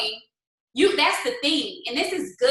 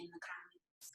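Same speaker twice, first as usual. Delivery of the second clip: whisper; 8 semitones lower, 28 dB quieter than usual. Real, not acted. Aside asking viewers for comments.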